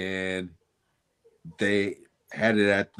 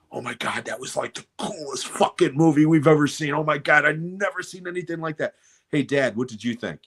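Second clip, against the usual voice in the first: excited gushing; 6 semitones higher than usual. Retold or imitated. Teen gushing after the movie.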